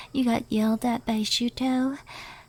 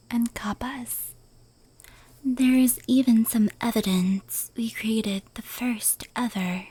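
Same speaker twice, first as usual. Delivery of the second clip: whisper. Acted. Yokai recounting fireworks lore to the listener, whispered. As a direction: hush every word as if telling a secret.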